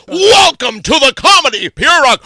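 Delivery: very high, loud, stretched-out announcer yell; hype host shout. Shown as a demonstration. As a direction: blast it like an arena announcer. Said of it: "You wanna see it?".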